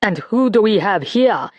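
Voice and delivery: ordinary delivery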